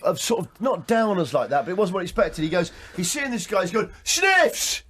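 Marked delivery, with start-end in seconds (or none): 4.0-4.8 calling out to a mate. Retold or imitated. Retold pub greeting, mate calling.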